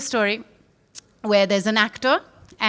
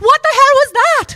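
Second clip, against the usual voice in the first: fast, high, loud yell of shock; acted startled shout at a sudden bang; startled outburst; 11 dB louder, faster than usual. Actor jolted on stage by the cannon, shocked.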